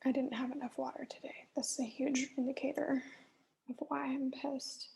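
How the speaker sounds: quick, hushed self-diagnosis; fast whisper. Real, not acted. Dehydrated vlogger explaining her anger, quick whisper. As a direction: whisper it fast, half to herself.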